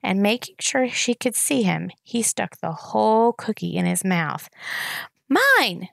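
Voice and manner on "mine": put-on high, loud toddler scream. A narrator's reading. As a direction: shriek it like a furious toddler. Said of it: "screamed Boots".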